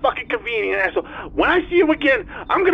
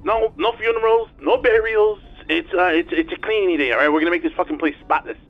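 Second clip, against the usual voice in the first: tinny talking telephone; 3 semitones higher, 4 dB louder than usual. Acted. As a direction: voice over a speakerphone. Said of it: "answered with the speaker on".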